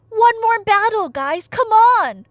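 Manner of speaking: loud, high pep-talk yell; a king shouting at his weary brothers to fight on; rallying shout. Acted. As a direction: fire up the tired troops.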